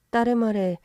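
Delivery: usual speaking voice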